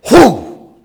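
loud, high "whoo" of a swing; vocal whack of a mallet coming down; hammer-blow whoosh. Retold or imitated. Imitating Jael striking the tent peg, hammer-blow whoosh.

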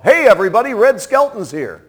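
loud, high call across a theater; yelled announcement. Retold or imitated. Man in the theater yelling that Red Skelton is there. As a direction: shout it to the whole room. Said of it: "a voice yelled out".

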